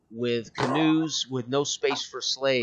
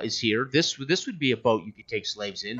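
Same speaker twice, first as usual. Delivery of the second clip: ordinary delivery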